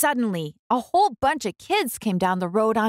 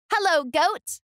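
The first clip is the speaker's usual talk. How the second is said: much higher, bright little duck greeting; cheerful duck voice; 10 semitones higher than usual. A narrator's reading. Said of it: "said Duck".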